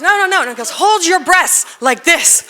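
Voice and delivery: the doctor at wit's end, showing her; insistent doctor. Retold or imitated. Doctor swearing, demonstrating pose.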